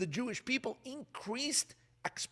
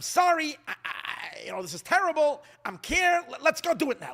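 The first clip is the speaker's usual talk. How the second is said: urgent apology; 8 semitones higher, 5 dB louder than usual. Acted. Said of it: "That's kind of what God is saying".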